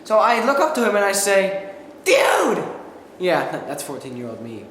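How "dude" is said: high, loud indignant yell; yelling dude. Retold or imitated. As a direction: yell it like an outraged teen. Teen yelling at shooter.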